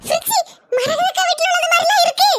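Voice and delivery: high-pitched flustered exclaiming; alarmed dismay. Acted. Neighbours watch belongings float by in flood. (பதற்றமான உயர்ந்த குரல்)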